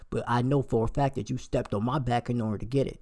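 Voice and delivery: ordinary talk, nothing marked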